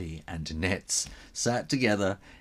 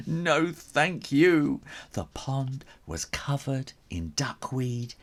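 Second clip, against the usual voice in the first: usual speaking voice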